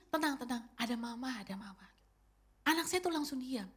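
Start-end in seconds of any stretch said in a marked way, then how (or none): 0.1-1.9 softening from gentle to a whisper; tender soothing. Retold or imitated. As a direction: hush a scared child to sleep. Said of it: "saya akan peluk dia".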